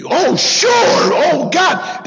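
high, alarmed oh God; suddenly spooked at the tattooed man, voice jumping up; startled homeowner. Retold or imitated. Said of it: "And they".

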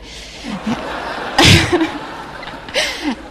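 a long embarrassed laugh at herself; a warm self-mocking laugh; rueful laugh. Real, not acted. Laughing at her own denial.

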